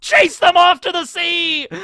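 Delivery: high, loud, slow charge cry; comic war cry; mock battle shout. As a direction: drive them off to the sea with a hammed-up yell. Chasing snakes to sea.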